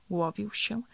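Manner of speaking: ordinary delivery, nothing marked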